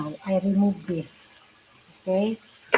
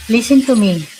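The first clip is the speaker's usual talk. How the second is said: raised voice; 9 dB louder than usual. Real, not acted. Frustrated argument about the headset.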